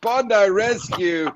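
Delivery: high, drawn-out triumphant proclamation; winner announcement. Real, not acted. Host proclaiming the contest winner to the audience, triumphant announcement. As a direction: announce the champion, stretching it out.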